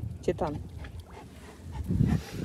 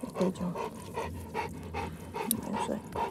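whispered coaxing; 3 semitones lower, 15 dB quieter than usual. Real, not acted. Cooing to tired dog.

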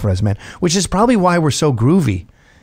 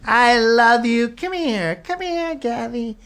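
put-on drunk gushing woman; 10 semitones higher than usual. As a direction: gush like a tipsy mom clinging to him. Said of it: "They're like".

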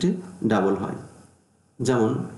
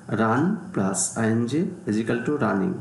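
slow and deliberate; measured, step-by-step reading; slow formula reading; much slower than usual. Shown as a demonstration. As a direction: spell the rule out for students. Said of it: "teacher reads formula aloud".

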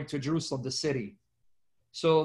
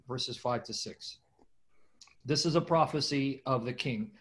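normal talking voice

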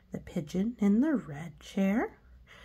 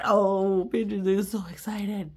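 excited oh; 5 dB louder than usual. Acted. Pigeon excited.

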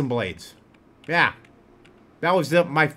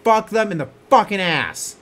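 angry rant; 6 semitones higher, 7 dB louder than usual. Real, not acted. Ongoing rant.

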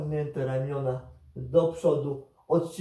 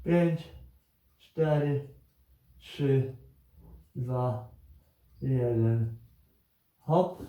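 counting down to go; slower than usual. Acted. Coach counts down a timed hold.

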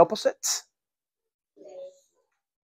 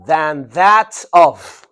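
loud, drawn-out THAN THAT OF; slow model phrase; 7 dB louder, much slower than usual. Shown as a demonstration. Teacher modelling a phrase for students, slowly and loudly. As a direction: stretch each word of "Than that of" firmly.

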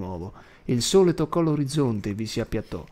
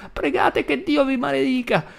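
put-on high shrill-loud old woman cursing; high furious old woman's curse; cursing grandma; 13 semitones higher, 4 dB louder than usual. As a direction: fling the curse, frantic.

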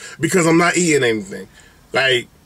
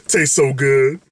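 ordinary delivery

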